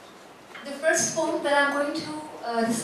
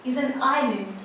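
poem recitation. Acted. Poet reciting own poem to a festival audience, measured recitation. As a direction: recite it phrase by phrase, letting each image land.

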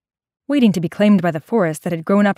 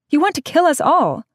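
put-on higher, alarmed girl; sharp accusing teen girl; 8 semitones higher than usual. A narrator's reading. May accusing Wesley.

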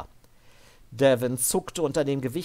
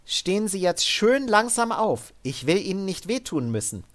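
higher, firm but controlled order; armored soldier woman; 8 semitones higher than usual. Acted. Sid ordering Devin up at gunpoint, higher and firm. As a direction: keep him steady with a calm threat. Sid's character voice.

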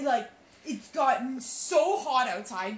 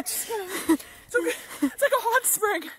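whining about heat; 8 semitones higher than usual. Real, not acted. Hot water, whining.

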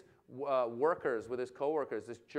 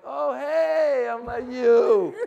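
high, loud sheepish greeting; mock recognition; 10 semitones higher, 13 dB louder, much slower than usual. Comedian spotting the lady he met, loud mock recognition. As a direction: overdo a caught-out hello.

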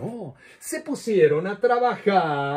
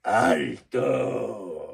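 much lower, growled "¡Alto!"; growled monster stop; 14 semitones lower than usual. Acted. Lion monster growling at the children to halt, deep growl. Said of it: "gruñó".

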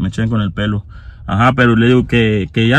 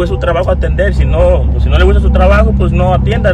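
higher, louder, faster retold scolding; raised voice; 6 semitones higher, 6 dB louder than usual. Scolding the dealership clerk, louder and higher. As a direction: push it hard like losing patience. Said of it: "Así, ¿verdad?".

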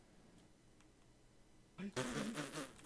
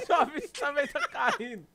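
normal talking voice

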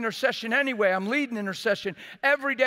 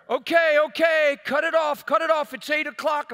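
raised, higher voice cutting in; brusque interruption; 4 semitones higher, 3 dB louder than usual. Retold or imitated. Retold leader stopping a prayer for the clock, brusque interruption. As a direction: break in loudly to call a halt.

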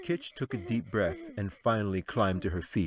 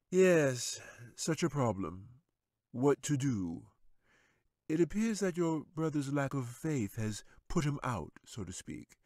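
smug wizard; 6 semitones higher than usual. A narrator's reading. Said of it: "Gelzuin said, and smiled".

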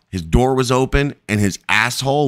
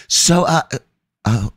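halting, imitating someone distracted by attraction; re-enacted tongue-tied admiration; retold flustered ogling; 2 semitones lower than usual. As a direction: stammer to a stop, mock smitten. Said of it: "starts staring at him and then flirts".

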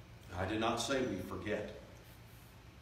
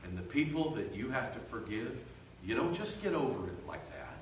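ordinary talk, nothing marked

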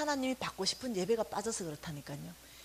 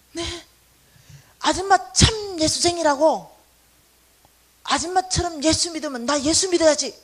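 excited vendor; 5 semitones higher, 11 dB louder than usual. Retold or imitated. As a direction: proclaim it like a convert. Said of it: "vendor praising her faith".

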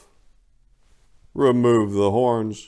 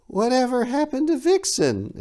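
put-on much higher curious boy; child voice. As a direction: ask dad like a small kid. The boy again.

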